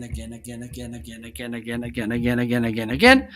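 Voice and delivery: even chant coming closer, popping up at the end; moving around microphone. Shown as a demonstration. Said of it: "Let me move around again".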